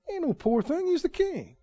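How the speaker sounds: high, soft sarcastic rebuttal; mock-high dismissal; mocking retort. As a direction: scoff at the pity. Preacher mocks pity.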